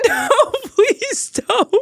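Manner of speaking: very high, squeaky mock plea; hammed-up high-pitched pleading. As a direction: beg in an exaggerated high voice. Acted plea to angry dad.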